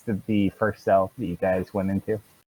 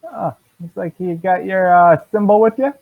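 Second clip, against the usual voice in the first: cheery guard; 9 semitones higher, 4 dB louder than usual. Acted. Guard greets new paladin.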